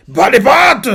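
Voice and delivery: high, loud snap like a madman; crazed yell. Retold or imitated. Lance yelling at Kevin over the cream puff, wild yell. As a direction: bark it like a lunatic. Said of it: "Il lui crie dessus, quoi, on dirait un fou".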